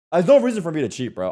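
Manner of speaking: ordinary talk, nothing marked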